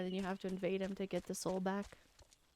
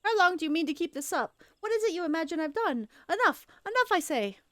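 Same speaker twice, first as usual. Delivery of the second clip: higher and louder, snapping Enough; exasperated outburst; 9 semitones higher, 6 dB louder than usual. Acted. Cornered Laurentius shouting at the adventurer to stop. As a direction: lose patience and shout it down.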